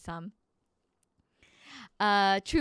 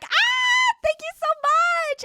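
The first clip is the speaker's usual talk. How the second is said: excited; 7 dB louder, much slower than usual. Real, not acted. Thanking viewer for compliment.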